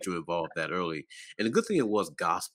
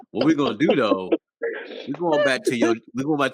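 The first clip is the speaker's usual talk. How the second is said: louder, laughing stop, whoa whoa; loud playful interruption; 6 semitones higher, 7 dB louder than usual. Real, not acted. Host halting the guest's story, loud laughing protest. Host redirects topic, teasing.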